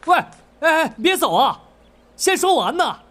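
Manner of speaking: high, urgent call to stop; calling after someone. Acted. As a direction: call them back before they get away. Calling after the departing innkeeper.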